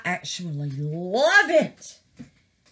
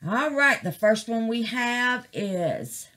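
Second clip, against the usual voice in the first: ordinary talk, nothing marked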